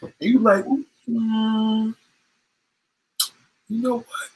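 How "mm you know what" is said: coy give-in. Retold or imitated. Woman giving in, coy.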